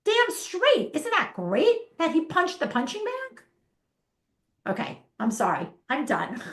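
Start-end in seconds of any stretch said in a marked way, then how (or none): 0.0-3.4 much higher, loud, fast in the middle; gasp, then flip it into a cheer; mock outrage turned to cheering. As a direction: parent reacting to the punching bag, loud and high. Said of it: "We look at it negatively".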